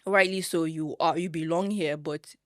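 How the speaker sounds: ordinary talk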